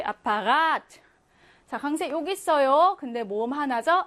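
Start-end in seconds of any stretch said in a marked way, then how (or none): 0.0-1.0 "아파가트" with the last A held long; German "아파가트", drawn-out stressed vowel. Shown as a demonstration. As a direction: stretch the "가" in "아파가트". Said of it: "길게".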